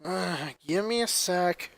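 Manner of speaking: mildly annoyed receptionist; annoyed groan. A narrator's reading. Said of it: "annoyed groan".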